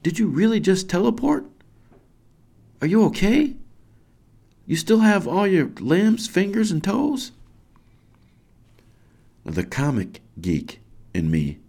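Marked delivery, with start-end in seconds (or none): 0.0-7.3 high, amazed, urgent questions; excited scream. Acted. Parent checking on teleported boy, excited scream. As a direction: fire off the questions high and astonished. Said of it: "I scream and, and jump".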